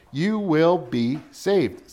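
very slow, word-by-word declaration; slow and emphatic. Real, not acted. Preacher declaring the verse's promise, slow and emphatic. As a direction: draw out "you will be saved", each word weighted.

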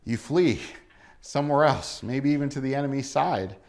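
usual speaking voice

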